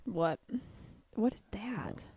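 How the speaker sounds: whispered question; hushed, breathy ask. Real, not acted. Whispered reaction to a camera filter.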